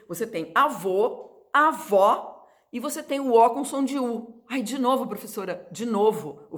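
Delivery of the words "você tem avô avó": "avô" with a closed o, then "avó" with an open ó. Shown as a demonstration. Teacher contrasting the two O sounds for students. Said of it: "O O em português".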